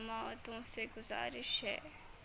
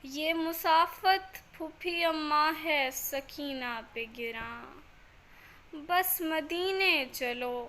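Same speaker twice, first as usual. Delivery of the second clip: louder, projected verse, each line dropping at the end; elegiac poem recitation; 3 semitones higher, 10 dB louder than usual. Acted. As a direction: declaim the salaam line by line, with pauses. (बुलंद आवाज़ में सलाम पाठ)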